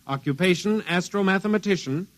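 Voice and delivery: measured official announcer read; radio bulletin read. Acted. Radio man broadcasting to all agencies, formal announcer read. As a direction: call it out like a bureau radio bulletin.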